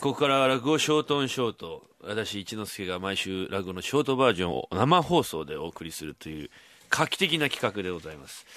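deep, solemn put-on presenter voice; a mock-grand announcement; put-on announcer voice. 番組の冒頭で企画を紹介する、わざと作った低い声. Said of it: "なんか、声色作ってらっしゃるから".

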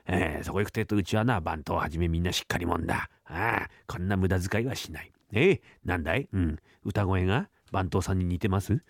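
a miserly boss nagging on the walk home; stingy master grumbling. Acted.

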